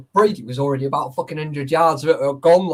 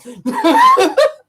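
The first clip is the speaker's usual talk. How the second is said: loud laugh; 11 dB louder than usual. Real, not acted. Laughing at his own story.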